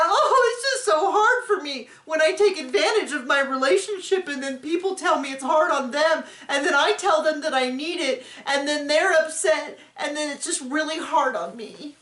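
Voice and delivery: high, whiny mock lament; hammed-up self-pity, high and pleading; mock crying Robin. Mocking Robin crying to the camera, high and whiny. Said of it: "Start crying".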